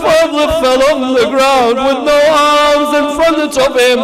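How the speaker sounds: high, loud wailing sermon delivery; full-force lament. Real, not acted. Preacher mourning Abul Fadl's fall before the congregation, full-force lament. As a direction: cry out each phrase in sustained high lament.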